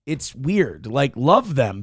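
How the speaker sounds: usual speaking voice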